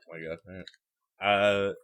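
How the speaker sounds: talking around a toothbrush; words muffled by a toothbrush; toothbrush in mouth. Real, not acted. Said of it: "I have a toothbrush in my mouth".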